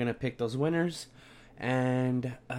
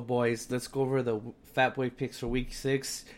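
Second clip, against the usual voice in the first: ordinary talk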